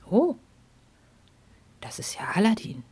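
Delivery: hushed, reverent whisper; awed whisper. Acted. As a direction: whisper in awe. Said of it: "Leise und nahezu ehrfürchtig".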